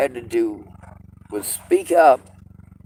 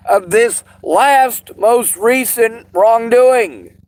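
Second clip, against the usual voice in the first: furious shout; 9 semitones higher, 6 dB louder than usual. Real, not acted. Furious rant about money and law.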